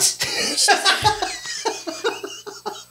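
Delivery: high breathless laughter; real laugh. Real, not acted. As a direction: burst out laughing. Laughs after story.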